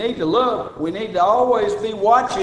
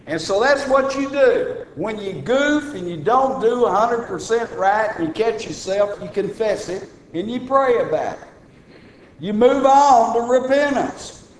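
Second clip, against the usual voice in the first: raised pulpit voice urging the flock; preaching at full force; 6 semitones higher, 3 dB louder than usual. Acted. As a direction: drive the lesson home from the pulpit. Preaching to congregation.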